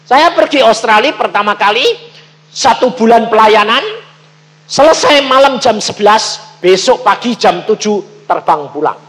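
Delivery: loud, high, driving sermon telling; preaching at full force. Acted. Preacher to congregation, telling of his Australia trip, loud preaching. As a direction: belt the story out to the congregation.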